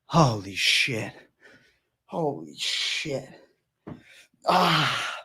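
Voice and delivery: slow, winded and strained; out of breath. Real, not acted. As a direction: gasp it out, exhausted. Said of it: "The arms are cramping".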